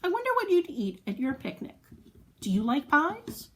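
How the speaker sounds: plain reading voice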